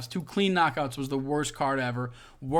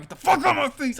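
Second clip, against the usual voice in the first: high, loud exasperated yell; pissed-off outburst; 12 semitones higher, 6 dB louder, faster than usual. Acted. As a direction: spit it out in exaggerated fury. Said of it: "I'm pissed off".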